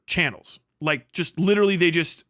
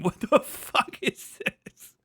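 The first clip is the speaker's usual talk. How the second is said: high, slow, laughing "what the fuck"; laugh-strained, drawn-out question; laughing disbelief; 7 semitones higher, 4 dB quieter, much slower than usual. Real, not acted. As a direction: cackle it out in disbelief. Laughing disbelief at absurd image.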